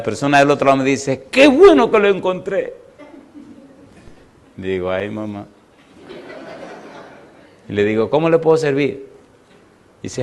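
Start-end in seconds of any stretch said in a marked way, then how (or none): 1.3-2.7 high, loud burst of relief; excited caller. Retold or imitated. Caller relieved to find pastor. 4.9-5.5 soft, sinking mutter to himself; dismayed aside. Retold or imitated. Pastor's dismayed aside on phone. 8.1-9.1 higher-pitched courteous question; polite phone enquiry. Retold or imitated. Polite first question to caller.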